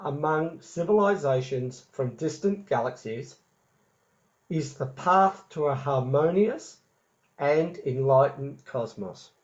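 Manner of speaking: slow, measured dictation; dictating. Shown as a demonstration. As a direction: spell it out slowly for note-taking. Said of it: "Could you say that one again".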